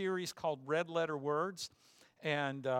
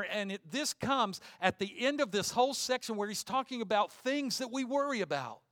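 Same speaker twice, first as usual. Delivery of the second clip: ordinary talk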